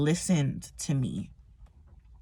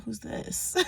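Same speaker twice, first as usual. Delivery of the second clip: whispered quote; 6 semitones higher, 9 dB quieter than usual. Retold or imitated. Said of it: "They was like".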